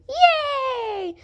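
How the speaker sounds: high, drawn-out cheer; excited cheer. Acted. Friend agrees to one last thing, excited. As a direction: cheer with delight.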